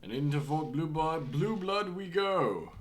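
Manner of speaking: high, loud heroic declaiming; stagey fake archaic declaiming. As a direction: proclaim it like a storybook knight. Announcing entry in character.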